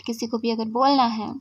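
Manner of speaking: ordinary talk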